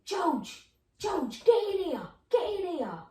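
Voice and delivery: high, loud call to the next room; mock yell for George to come; shouting out. Mother calling her husband from the kitchen, mock shout. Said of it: "she's shouting out".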